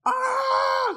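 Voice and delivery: loud, very high sustained scream; imitated scream. Shown as a demonstration. Preacher showing how the girl was screaming, high loud scream. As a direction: let out a piercing imitation shriek. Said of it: "when I say screaming".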